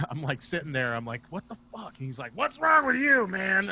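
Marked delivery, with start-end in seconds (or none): 1.3-1.9 higher, softer mock disbelief; baffled reaction. Retelling his baffled reaction to Bob. 2.3-3.7 loud, high-pitched hollering at a friend; mock shouted challenge; Bob. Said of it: "Bob's really loud".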